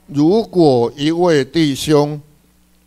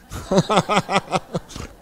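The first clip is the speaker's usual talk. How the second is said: a soft, trailing real laugh; laughing fondly at the slow reader; amused laugh; 4 semitones higher, 6 dB quieter than usual. Real, not acted. Preacher telling the congregation about a brother who lost his place, soft laugh.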